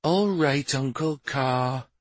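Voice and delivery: put-on high boy's voice, slowing; reluctantly agreeing boy. A narrator's reading. Boy accepting his uncle's advice, reluctant. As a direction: give in with a high, slowed reply. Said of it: "frowned, but nodded and said".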